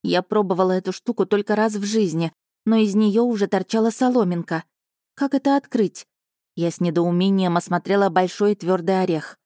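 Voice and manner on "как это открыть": puzzled. A narrator's reading. Puzzled by hard coconut.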